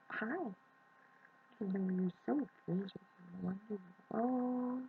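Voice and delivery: soft, high coo; hushed, high pet-talk. Real, not acted. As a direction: murmur adoringly to a kitten in your hands. Talking to the kitten she holds.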